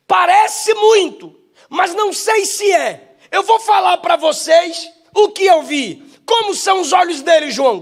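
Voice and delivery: loud, high sermon voice, slowing on key words; fiery preaching. Acted. Preacher shouts the vision of John to the church, loud and high. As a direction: preach it at full force.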